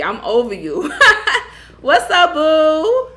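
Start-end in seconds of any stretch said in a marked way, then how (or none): 1.0-1.8 loud high burst of laughter. Real, not acted. Laughing at a viewer's name. 1.8-3.1 loud hello through laughter; laughing greeting. Real, not acted. Greeting while still laughing.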